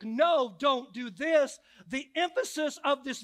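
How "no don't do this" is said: louder, slowed, each word hammered; stern emphatic warning, slow and firm. Shown as a demonstration. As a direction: put full emphasis on "No, don't do this". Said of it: "the emphatic".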